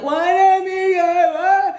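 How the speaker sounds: high, loud offended squeal; mock offended squeal. Someone offended at not being picked, high loud squeal. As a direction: squeal it up high, all wounded pride.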